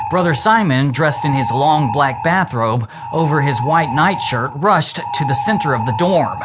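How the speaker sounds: ordinary delivery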